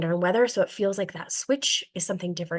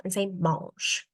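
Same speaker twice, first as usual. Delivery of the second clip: slow mange demo; much slower than usual. Shown as a demonstration. Teacher shows kids mange, slow like chewing. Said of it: "put it into your mouth".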